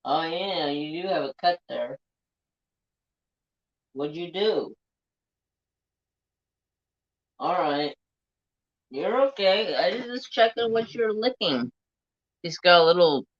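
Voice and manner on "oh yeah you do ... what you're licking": pet talk. Real, not acted. Checking the pet's hurt leg.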